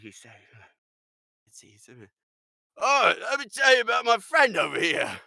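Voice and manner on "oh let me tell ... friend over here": put-on loud, high drunken blabbing; drunken goon. Goon spills the plans when summoned, loud drunken voice. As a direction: play a loose-lipped drunk, pitched up and booming. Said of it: "he's being buttered up and drunken".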